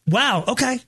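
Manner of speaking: usual speaking voice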